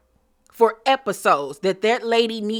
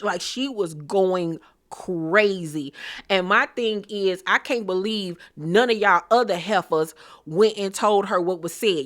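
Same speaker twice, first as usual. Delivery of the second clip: normal talking voice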